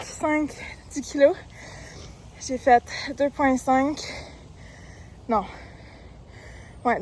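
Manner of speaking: panting between sentences, loud and breathless; catching her breath mid-run; out of breath while running. Real, not acted. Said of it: "J'ai repris mon souffle un peu".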